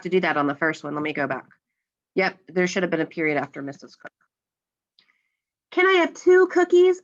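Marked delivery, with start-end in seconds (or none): 5.7-7.0 high, eager asking; asking a question. Acted. Teacher acting an example question for students, asking. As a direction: ask it high and keen. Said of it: "I asked".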